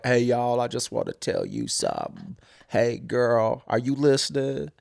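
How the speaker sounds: lightly put-on slangy musician voice; mock cultural appropriator. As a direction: imitate a performer stalling before the song. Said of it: "walking example of cultural appropriation".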